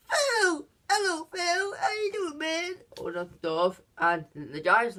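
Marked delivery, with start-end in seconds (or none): 0.1-2.7 much higher, strained mock-cheerful voice; imitating Axel's squeaky, hammed-up hello; over-friendly greeting. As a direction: barge in like a fake long-lost buddy. Axel faking a friend.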